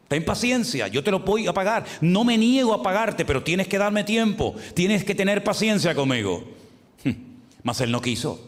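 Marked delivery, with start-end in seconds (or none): 0.1-6.4 higher, urgent plea for more time; preacher voicing a desperate debtor pleading for patience; begging. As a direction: beg him not to collect yet. Servant begging his fellow servant.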